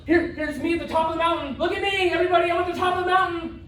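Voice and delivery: high, sing-out show-off voice; hammed-up self-promotion; mock bragging. Mimics friends bragging in posts.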